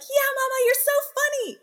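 high, bright children's chorus imitated; little kids' voices. Mom imitating her two kids praising her, high childlike. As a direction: squeak it like small kids cheering mom. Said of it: "they're like".

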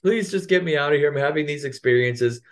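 high pleading whine; mock the whiny boyfriend begging; imitating a whiny plea to be saved. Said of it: "he's just like".

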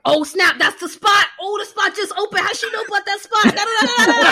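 mock-excited quote, high and loud; imitating excited friend. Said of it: "he's too deep into the show".

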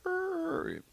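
a dumbfounded official, squeaky; mock stammer. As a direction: play caught off guard, high and quiet. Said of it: "They're like".

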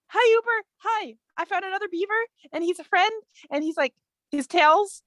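high, eager chatter; excited greeting. Acted. Hava tells Uber about another beaver, high and eager. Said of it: "go up to Uber and say".

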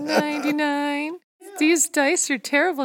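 rising, wailing disbelief; mock despair. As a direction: whine it like a lament. Player repeats critical fail roll.

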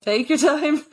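said through a laugh. Real, not acted. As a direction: laugh while reassuring. Host laughs at guest choking.

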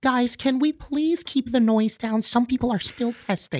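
teacher hushing students, mock pleading; imitating teacher. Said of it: "the teachers will come out like".

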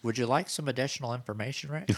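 high, singsong virtual assistant; mock a helpful voice assistant making an offer; Google assistant. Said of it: "Do they say it in that voice?".

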